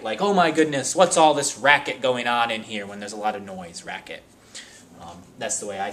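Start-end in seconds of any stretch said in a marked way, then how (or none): 0.2-2.8 exasperated. Pretend. Acted complaint about noise.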